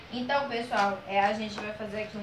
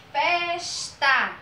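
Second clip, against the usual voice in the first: FESTA called out in two beats, FES held long; measured call of FESTA, stress on the first syllable; calling a neighbour; 3 semitones higher, 4 dB louder than usual. Shown as a demonstration. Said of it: "como se você estivesse chamando o vizinho".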